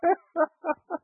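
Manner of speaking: sudden high-pitched laugh; high burst of laughter. Real, not acted. Laughing at the juice fast.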